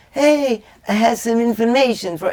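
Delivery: hammed-up high nerd voice; nerdy guy impression. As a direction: squeak it out like an eager computer geek. Mocking the nerdy computer guy.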